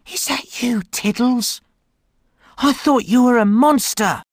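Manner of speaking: put-on high whispery boy voice; child voice. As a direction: whisper to the cat in relief.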